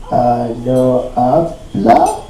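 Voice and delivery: low, soft, very slow, rising question; unsure parroting. Acted. Worker parroting a taught phrase to his boss, haltingly. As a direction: try out a phrase you barely know.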